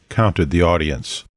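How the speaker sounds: ordinary delivery, nothing marked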